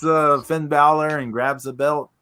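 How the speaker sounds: ordinary talk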